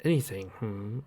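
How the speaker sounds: low, soft menacing murmur; sinister man voice. Acted. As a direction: toy with her quietly. Captor voice.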